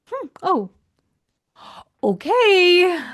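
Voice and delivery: high, drawn-out taken-aback "okay"; affronted reaction. Pretend. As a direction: act out offended disbelief. Acting out affronted reaction to husband.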